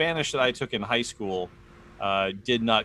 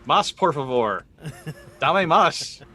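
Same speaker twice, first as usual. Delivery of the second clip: eager yes please; 5 semitones higher, 6 dB louder, much slower than usual. Retold or imitated. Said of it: "the rest of us just went like".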